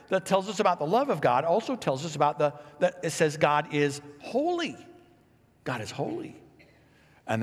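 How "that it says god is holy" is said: usual speaking voice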